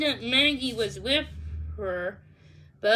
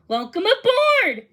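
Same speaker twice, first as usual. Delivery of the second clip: higher, louder hearty welcome; cheerful welcome; 8 semitones higher, 6 dB louder than usual. Acted. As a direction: throw the doors open with your voice. Danny welcoming the scouts.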